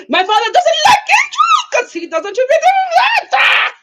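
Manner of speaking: rushed, high mock French accent; loud, exaggerated French accent; Amira. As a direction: rush it out in a squealing French accent. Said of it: "does she have a French accent".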